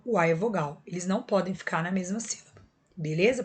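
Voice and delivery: normal talking voice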